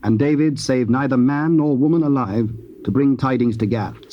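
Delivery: ordinary talk, nothing marked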